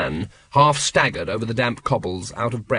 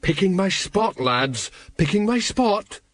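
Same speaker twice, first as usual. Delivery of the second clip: quavering; 6 semitones higher than usual. A narrator's reading. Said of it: "quavered Colon".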